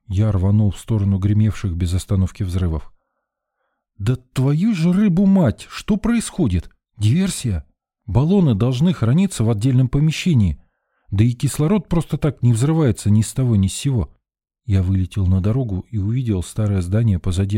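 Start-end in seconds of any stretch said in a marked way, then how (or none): 4.0-14.1 high, heated exclamations; worked-up, incredulous ranting, pitched up; exasperated outburst. A narrator's reading. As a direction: curse and demand to know what happened. Rescuer swears at new explosions.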